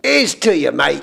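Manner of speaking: toast in a fake Cockney; mock Cockney. Host toasting the letter writer, in mock Cockney. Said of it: "highly criticized for his accent".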